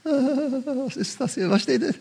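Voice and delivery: high, hushed, drawn-out bewilderment; mock alarmed onlooker. Bystander watching someone manifest, mock uneasy.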